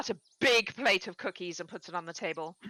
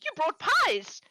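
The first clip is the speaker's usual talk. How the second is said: put-on overexcited old woman; 8 semitones higher than usual. Lonely farm wife greets the visitors, high and gushing. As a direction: squeal with delight at visitors. Gertrude surprised by pies.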